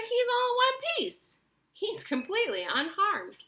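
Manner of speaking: delighted. A narrator's reading. Wonka delightedly assures the parents the boy is fine. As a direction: proudly report success. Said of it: "cried Mister Wonka".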